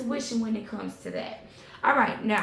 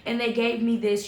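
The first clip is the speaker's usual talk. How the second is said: ordinary talk, nothing marked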